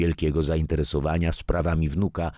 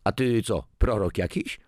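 higher, a mocking scoff; 6 semitones higher than usual. A narrator's reading. Father scoffs at son's warnings, mocking. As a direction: snort it at him with contempt. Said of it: "parsknął Adam".

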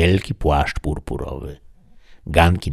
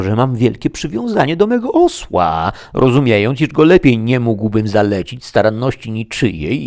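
raised, hurried excuses of a simple squire; flustered Sancho; 6 semitones higher, 4 dB louder than usual. A narrator's reading. Sancho excusing himself to the duchess, raised and eager. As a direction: protest your innocence, a bit flustered.

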